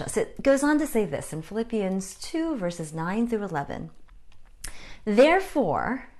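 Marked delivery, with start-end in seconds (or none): 5.0-6.1 "Therefore" drawn out slowly and punched louder; stress on Therefore. Real, not acted. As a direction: lean hard on "Therefore", slow and loud, ending on a rise. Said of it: "emphasis on the therefore".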